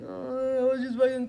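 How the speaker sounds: hammed-up little cry; imitated crying. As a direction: imitate bawling like a little girl. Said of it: "crying my eyes out like a little girl".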